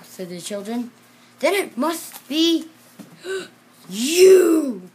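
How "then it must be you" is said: gasp, then loud higher you; shocked discovery. Acted. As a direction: realize it's the seal and gasp. Teacher discovers the seal.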